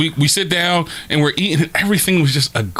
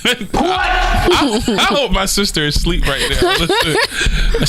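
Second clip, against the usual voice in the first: laughing; 8 semitones higher, slower than usual. Real, not acted. Laughing after sister joke.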